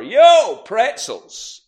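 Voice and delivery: loud, high vendor cry; market shout. Retold or imitated. Boston vendor selling beer, shouting. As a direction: hawk beer across the market. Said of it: "they would just shout".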